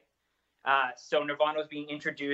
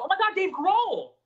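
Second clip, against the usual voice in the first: star-struck; 13 semitones higher, 3 dB louder than usual. Retold or imitated. Retells star-struck check-in moment.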